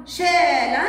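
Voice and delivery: شالا pronounced loudly as "shaalan"; fatha tanween ending. Shown as a demonstration. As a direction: punch out شالا with a ringing final n. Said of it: "بالفتحة".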